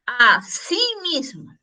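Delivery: "Así mismo" slowly split as a, sí, mismo; split in three parts. Shown as a demonstration. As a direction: draw out "Así mismo" so all three words stand apart. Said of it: "El separado en tres partes".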